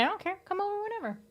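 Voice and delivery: insistent invitation. Real, not acted. Streamer insisting a friend come over, high pitched. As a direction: beg them to drop by, high and eager. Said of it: "repeating invitation insistently".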